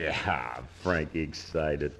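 low, slow and very soft; dry disbelief. Acted. Bandleader scoffs at his guitarist being excited, dry disbelief. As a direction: drag it out low, doubting every word.

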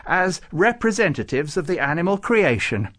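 high, loud eager addition; excited. A narrator's reading. Farouk speaking up for animals, excited. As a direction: press the case with excitement. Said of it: "said Farouk excitedly".

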